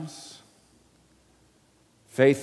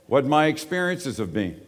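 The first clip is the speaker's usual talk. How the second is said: normal talking voice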